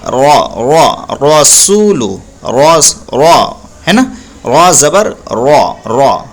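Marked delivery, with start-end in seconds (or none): 0.1-1.1 रॉ slow and heavy, mouth rounded; drawn-out heavy रॉ, रॉ; thick ra sound. Shown as a demonstration. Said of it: "रा को मोटा पढ़ते हैं". 2.5-3.5 रॉ slow and heavy, mouth rounded; drawn-out heavy रॉ, रॉ; thick ra sound. Shown as a demonstration. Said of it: "रा को मोटा पढ़ते हैं".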